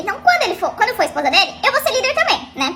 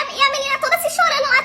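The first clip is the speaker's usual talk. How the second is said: ordinary talk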